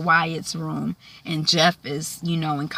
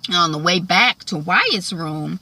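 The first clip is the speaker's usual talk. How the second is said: normal talking voice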